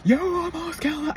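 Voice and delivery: high, cold threatening voice; a jagged stone man talking; retold menacing warning; whole different voice. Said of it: "I got into a whole different voice".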